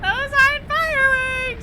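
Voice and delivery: loud, high, drawn-out correction; alarmed shout. Acted. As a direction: cry it out slowly over the din. Character alarmed at falling stars.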